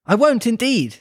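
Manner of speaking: lightly flustered Alice; hurried. A narrator's reading. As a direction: hasten to agree. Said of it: "in a great hurry to change the subject".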